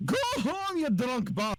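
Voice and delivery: high-pitched Irish accent. Shown as a demonstration. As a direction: put on a high, lilting Irish voice. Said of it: "let's say an Irish accent".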